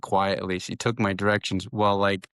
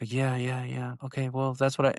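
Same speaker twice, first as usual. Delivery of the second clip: mock feisty daughter; 4 semitones higher than usual. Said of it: "she was getting kinda feisty with me".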